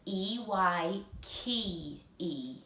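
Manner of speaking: slow, spelled out letter by letter; slow spelling. Shown as a demonstration. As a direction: spell each letter slowly for the class to echo.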